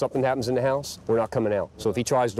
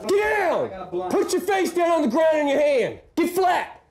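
cover me shout; slower than usual. Real, not acted. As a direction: force them flat with your voice. Officer forcing the suspect to the ground.

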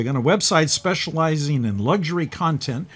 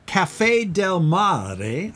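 Italian accent; 2 semitones higher, much slower than usual. Shown as a demonstration. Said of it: "I say it with my Italian accent".

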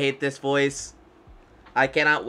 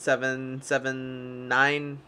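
slow number; slower than usual. Acted. Slowly reading number.

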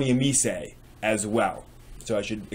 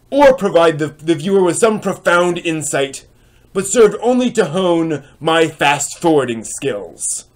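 higher and louder, a cranky critic holding forth; indignant dramatic reading; 5 semitones higher, 7 dB louder than usual. Acted. Reading a forum critic's scathing anime post aloud, indignant and dramatic. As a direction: read it like an outraged reviewer on a soapbox.

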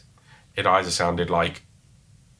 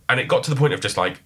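normal talking voice

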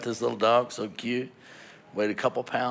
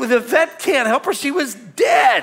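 high, loud insistent protest; climbing to a desperate yell; exasperated shouting; 10 dB louder than usual. Retold or imitated. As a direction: argue back at the top of your voice. Acts out arguing with wife.

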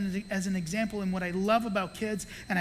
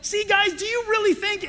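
much higher playful taunt; teasing the kids; 11 semitones higher, 5 dB louder than usual. Retold or imitated. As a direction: tease the crowd. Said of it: "I just went out".

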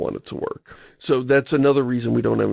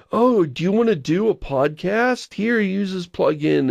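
higher-pitched, eager plugin pitch; mock helpful pitch; 7 semitones higher than usual. Host mimics plugin promising an easy podcast fix, mock helpful. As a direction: imitate an over-helpful plugin offering itself.

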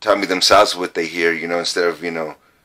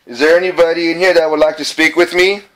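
raised voice addressing spirits; calling out to spirits; 10 semitones higher than usual. Real, not acted. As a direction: call out to the ghosts, projecting.

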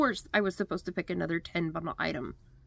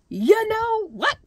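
mock-furious, much higher, slow and loud; hammed-up outburst of retold anger; mad; 14 semitones higher, 6 dB louder, slower than usual. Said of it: "I was mad".

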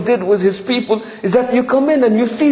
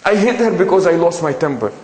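mock excuse; 6 semitones lower than usual. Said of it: "this we hear a lot".

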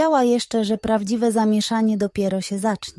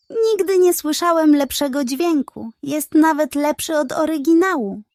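flattering pug; 6 semitones higher, 3 dB louder than usual. Acted. Pug flatters the hen about her hawk screech, higher. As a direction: lay the flattery on thick.

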